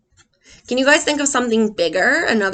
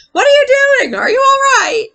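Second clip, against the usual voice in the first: high, loud, anxious shout; worried yell; 12 semitones higher, 5 dB louder than usual. Acted. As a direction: call into the water, afraid. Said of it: "I yelled".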